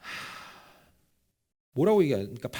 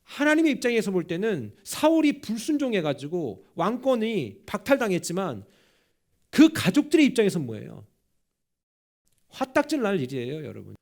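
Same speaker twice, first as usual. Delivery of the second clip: forceful preaching; 4 semitones higher, 6 dB louder than usual. Acted. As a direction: preach it out with full conviction. (힘 있게 외치는 설교)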